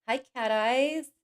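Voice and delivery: normal talking voice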